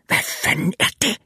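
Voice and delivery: urgent whispered curse; frightened whisper. A narrator's reading. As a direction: breathe it into his ear. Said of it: "hviskede Wireman i mit øre".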